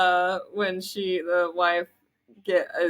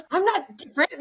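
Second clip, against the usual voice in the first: higher, indignant denial; wife denying she's acting different; wife's protest; 8 semitones higher than usual. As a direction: snap back defensively as the wife. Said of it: "she's like".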